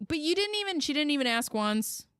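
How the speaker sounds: exasperated outburst. Real, not acted. Host exasperated at the mother.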